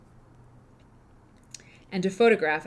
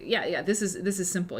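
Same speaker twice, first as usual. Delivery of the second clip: ordinary talk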